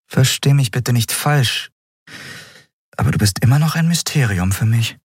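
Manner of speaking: gently tentative captain; careful captain. A narrator's reading. Captain speaks carefully to beast-man.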